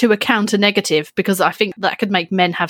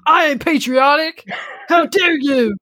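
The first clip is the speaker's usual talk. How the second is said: indignant American; 6 semitones higher than usual. Retold or imitated. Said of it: "some Americans going".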